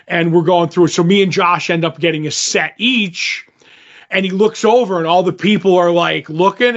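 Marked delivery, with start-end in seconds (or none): none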